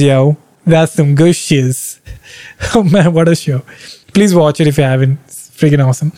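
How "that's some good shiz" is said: "That's some good shiz" drawn out very slowly; savouring it slowly on repeat. Real, not acted.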